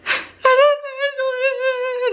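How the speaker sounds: high, drawn-out wordless wail; hammed-up sobbing, words lost in the cry; imitated crying. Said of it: "just crying, and I couldn't get words out".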